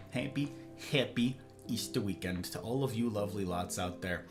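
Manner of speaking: soft, tired greeting; soft sighing. Real, not acted. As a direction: sigh then greet softly.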